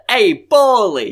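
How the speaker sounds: hammed-up greeting call; calling Paulie. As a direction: call out slowly across the street. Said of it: "you know how I had the".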